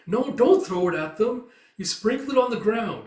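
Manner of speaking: calling out. Acted. Mother stopping her child throwing feed at the birds, high loud call.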